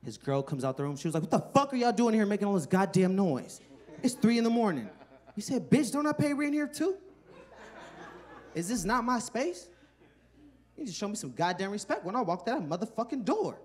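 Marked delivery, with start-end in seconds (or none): 1.2-4.9 angry scolding wife. Retold or imitated. 5.7-13.6 mock indignant boyfriend. Friend yelling at his girl.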